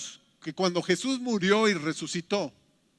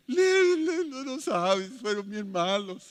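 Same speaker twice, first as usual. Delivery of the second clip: mock crying complaint; slower than usual. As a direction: play a disciple half-sobbing over the news. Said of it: "Y estaban llorando". (lamento lloroso exagerado)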